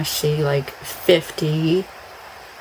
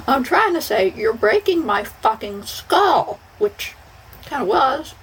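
high, laughing through the words; 9 semitones higher than usual. Retold or imitated. Retelling the dentist pain to viewers, laughing up high. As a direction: laugh out the complaint.

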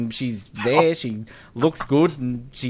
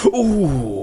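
impressed Bond; 6 semitones higher than usual. Retold or imitated. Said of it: "And he's like".

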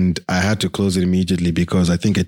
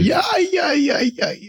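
excited. Real, not acted. Hyped about their own festival.

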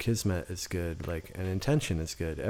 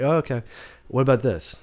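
eager reply; 7 semitones higher, 9 dB louder than usual. Retold or imitated. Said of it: "I'd be like".

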